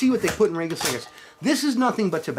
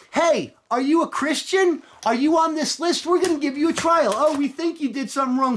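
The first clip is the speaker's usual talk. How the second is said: mock interrogator; 10 semitones higher than usual. Voicing secret police at door.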